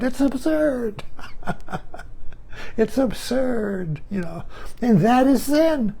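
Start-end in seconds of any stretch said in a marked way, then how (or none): 1.1-2.7 breathy silent chuckle; quiet amused laugh; soft laugh. Real, not acted. Laughs at absurdity.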